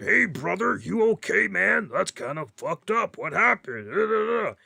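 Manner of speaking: high, loud, put-on brash wrestler's concern; Hulk Hogan. Host imitating a wrestler checking on a hurt rival, in a loud brash voice. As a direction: ham up a brash wrestler's mock sympathy. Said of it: "you see the Hulkster".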